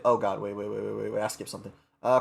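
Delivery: plain reading voice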